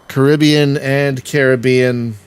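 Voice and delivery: "Caribbean" first with stress on "be", then on "Car"; two stresses of Caribbean. Shown as a demonstration. Player comparing both ways to say "Caribbean", stress shifting slowly. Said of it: "changing the emphasis on a word".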